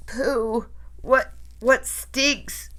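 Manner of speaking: a hint of a high, disgusted witch, slow; lightly voiced witches grumbling at a smell; disgusted witch cry. A narrator's reading. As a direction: sniff out the stink, mildly revolted. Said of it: "there were cries of".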